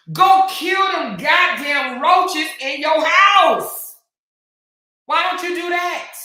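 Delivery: loud, slow mocking yell; shouting a taunt at a rival creator. Real, not acted. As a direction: shout the insult across the room.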